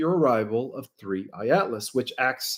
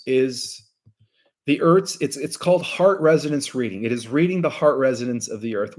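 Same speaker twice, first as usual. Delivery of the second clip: ordinary delivery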